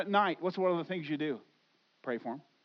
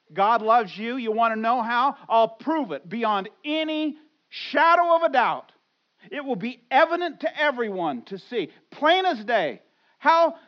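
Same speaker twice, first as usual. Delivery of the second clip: loud, high preaching build; full-force preaching; 4 semitones higher, 9 dB louder than usual. Acted. Pastor proclaiming God's love to the church, loud preaching. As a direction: preach it out to the congregation, big and rising.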